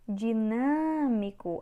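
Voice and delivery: "Dinâmico" slowed, with a long NÂ; stretched stressed syllable. Shown as a demonstration. Teacher showing students the tonic syllable, stretched stress. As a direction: draw out NÂ in "Dinâmico". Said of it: "A sílaba tônica é o nan".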